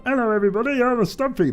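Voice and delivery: teasing a friend with a silly high imitation; imitating Stumpy. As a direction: pitch it way up and play the friend for laughs. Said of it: "That's literally how he sounds".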